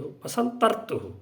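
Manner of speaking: "बसंत ऋतुः" said slowly, visarga echoed as "ऋतुहु"; slow careful recitation. Shown as a demonstration. Teacher repeats "बसंत ऋतुः" slowly for students to copy. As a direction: spell out "बसंत ऋतुः" slowly with a breathy ending. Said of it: "लेकिन इसका उच्चारण कैसे होता है?".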